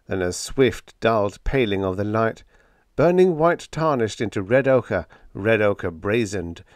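ordinary delivery

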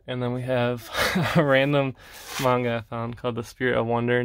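ordinary talk, nothing marked

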